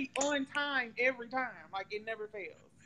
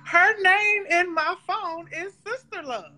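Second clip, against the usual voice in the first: said again louder and more insistent, pitch up; incredulous louder retelling; emphatic louder repeat; 5 semitones higher, 6 dB louder than usual. Real, not acted. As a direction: repeat it so she really hears it.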